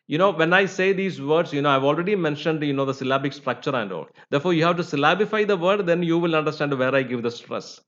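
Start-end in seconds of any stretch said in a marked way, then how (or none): none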